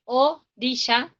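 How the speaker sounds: "orilla" slowly split after the first vowel, o - rilla; demonstrating a bad line break in "orilla": o, rilla; wrong syllable break. Shown as a demonstration. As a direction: break "orilla" as a lone o, pause, then rilla. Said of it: "no puedo decir".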